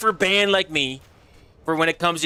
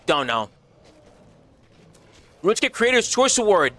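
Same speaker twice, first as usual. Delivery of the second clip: usual speaking voice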